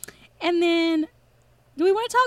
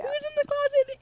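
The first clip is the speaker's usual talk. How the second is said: shocked question; 9 semitones higher, 3 dB quieter than usual. Retold or imitated. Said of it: "he's like".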